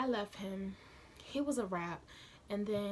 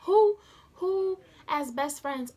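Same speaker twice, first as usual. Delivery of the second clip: very loud, slow, higher outburst; outraged; 7 semitones higher, 13 dB louder, slower than usual. Real, not acted. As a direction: blast it out in disbelief. Outraged at the two girls.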